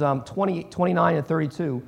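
ordinary talk, nothing marked

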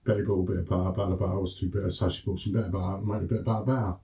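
rapid-fire Cockney, words run together; quick Cockney. Shown as a demonstration. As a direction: rattle it off in Cockney as if to your brothers. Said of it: "how it sounds when I speak to my brothers quickly".

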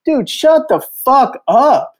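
high, slow, fed-up command; a stern retold order to stop; snapping at him. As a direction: cut him off hard. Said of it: "I just go".

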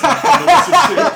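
high, loud laughing punchline. Real, not acted. Laughing at his own French jab to friends. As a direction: burst out laughing on the joke.

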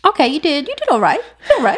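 high, laughing praise. Real, not acted. Teasing praise after right guess.

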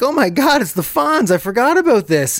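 high surprised fan voice; mock surprise. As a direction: gasp it out like a stunned fan. Imagined fans surprised.

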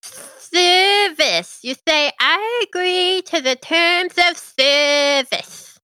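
brisk little servant imp. Acted. GM voices imp.

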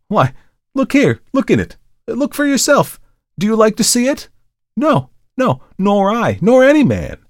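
high, loud, agitated outburst; agitated Markheim. Acted. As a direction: thrust it at the listener in horror.

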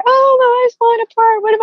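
replaying her own breakdown, high and wailing; mock crying. Telling a podcast host how she cried to her sister, high tearful wail. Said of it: "I'm just crying".

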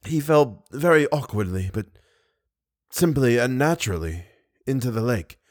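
soft, broken short phrases with pauses; weak and gasping. Acted. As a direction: fight for breath between words. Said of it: "speaking in short phrases and gasping for breath between the words".

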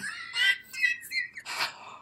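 high-pitched giggling fit; helpless high laughter. Real, not acted. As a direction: burst out in a squealing laugh. Cracks up at own joke.

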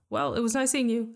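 loud, high call across the room; loud bright farewell; yelled. A narrator's reading. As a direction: call out a fake goodbye. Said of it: "I yelled".

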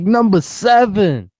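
high, slow hype-man holler; Funk. Retold or imitated. Co-host imagines the radio host working a drive-thru, mock hype holler. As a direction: draw it out as an over-hyped announcement. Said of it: "He's like".

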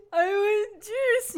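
put-on high, slow toddler wail; mock crying child. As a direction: imitate a small kid crying for something. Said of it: "the kid starts screaming".

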